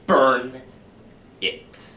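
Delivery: "Burn" barked loud, "it" dropped away; sharp loud command. Acted. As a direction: hit "Burn" hard, then let it die. Chanted burn commands, loud take.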